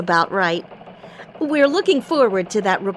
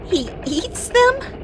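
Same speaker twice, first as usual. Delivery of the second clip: surprised; 13 semitones higher, slower than usual. Acted. Anchor shocked at bug-eating reindeer.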